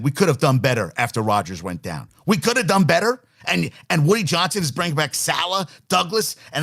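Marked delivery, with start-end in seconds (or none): 2.3-3.2 incredulous echo. Real, not acted. Radio host mocking the coach's quote, incredulous rising echo. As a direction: throw the quote back with a rising, disbelieving lift.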